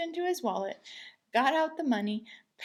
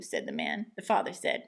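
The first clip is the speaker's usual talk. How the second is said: ordinary delivery, nothing marked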